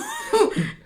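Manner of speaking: short high giggle. Real, not acted. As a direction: laugh it off. Laughs at partner.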